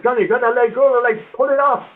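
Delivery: high, urgent mock shout; barking orders to grab the leg; retold urgent order. Bob ordering Johnny to pull the burning leg, high urgent shout.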